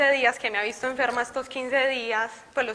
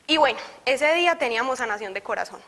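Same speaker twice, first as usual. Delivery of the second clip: ordinary delivery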